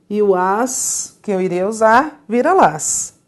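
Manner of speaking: ordinary talk, nothing marked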